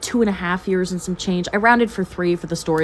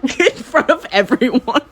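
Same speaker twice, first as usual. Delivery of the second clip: slow, higher words through laughter; real laughing; 4 semitones higher, slower than usual. Real, not acted. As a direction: laugh helplessly while getting the words out. Laughing at own story.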